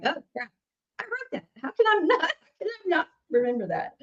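high, laughing mock dismay; mock panic. Singer to host, retelling her onstage shock in mock dismay. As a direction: play your own onstage shock for laughs.

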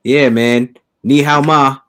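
usual speaking voice

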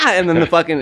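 ordinary delivery

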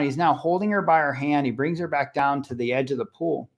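ordinary delivery

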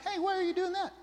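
high, pointed accusing question; demanding why, pitched high; antagonistic question. Shown as a demonstration. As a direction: throw the why at them like a challenge. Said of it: "It sounds antagonistic".

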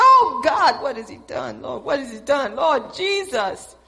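loud alarmed "Oh God" then worried questions; mother panicking at a school call; panicked outcry. Retold or imitated. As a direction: dread what the call means.

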